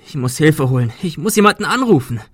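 loud, high-pitched alarm; panicked shouting. A narrator's reading. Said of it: "schrie Adrian".

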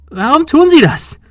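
terrified woman shrieks at the gunman; voice cracking. Acted. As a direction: let the voice break into a shriek. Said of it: "Ihre Stimme überschlug sich".

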